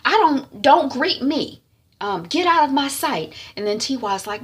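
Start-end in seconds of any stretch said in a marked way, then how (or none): 0.1-3.3 higher, insistent scolding; angry dismissal. Retold or imitated. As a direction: send her away as an offended mother. Mother dismisses daughter.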